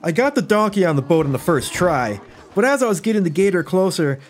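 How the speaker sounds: ordinary delivery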